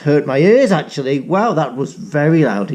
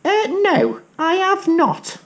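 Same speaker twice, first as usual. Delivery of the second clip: much higher squeaky rat voice; a little rat, nervous and small; high timid rat; frightened rat. Acted. Rat character speaks.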